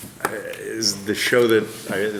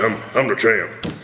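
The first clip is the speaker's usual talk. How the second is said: Hercule voice. Shown as a demonstration. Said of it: "I just kinda do".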